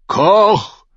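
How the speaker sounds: loud, high barked call; bellowing. Acted. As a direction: roar for the cook. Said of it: "brüllte er".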